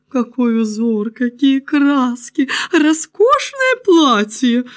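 high, drawn-out rapture; slow, swooning courtly praise; gushing admiration. A narrator's reading. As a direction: savour each compliment, stretching it out. Courtiers admire the invisible cloth.

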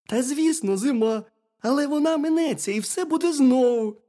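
high-pitched hedgehog consoling a friend; reassuring hedgehog. A narrator's reading. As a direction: cheer up the bear in a high voice. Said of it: "Та заспокойся".

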